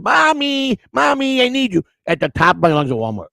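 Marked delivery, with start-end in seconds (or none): 0.0-1.8 put-on very high, pleading child wail; childish yelling for mommy. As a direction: call for mommy at the top of your lungs. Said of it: "yelling at the top of".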